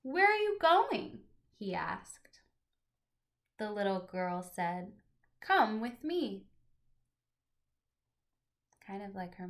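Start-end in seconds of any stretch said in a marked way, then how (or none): 0.0-1.2 put-on much higher, louder child's question; curious little boy. Teacher voicing the boy next door calling to the girl, high and eager. Boy across the hall asks. 1.6-6.5 gentle, expressive picture-book reading; reading to children. Acted. Teacher reading a picture book aloud to her class, storytelling voice.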